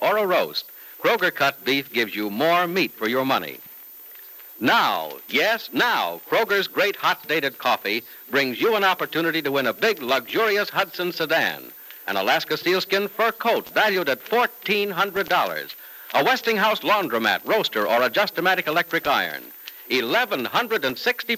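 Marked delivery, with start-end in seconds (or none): none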